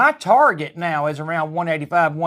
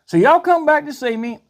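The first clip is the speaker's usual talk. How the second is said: cheery sign-off; 8 semitones higher than usual. Real, not acted.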